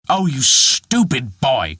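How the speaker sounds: slow, mild mock anger; cried in temper. A narrator's reading. Dwarf to Peter, a touch of temper. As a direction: lose patience with the boy. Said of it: "cried the dwarf".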